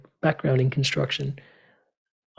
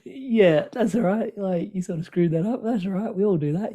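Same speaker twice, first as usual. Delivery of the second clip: higher, gentle reassuring voice; kind self-talk; 5 semitones higher than usual. Retold or imitated. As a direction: soothe yourself like a kind friend. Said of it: "communicating to myself with a little bit more extra love".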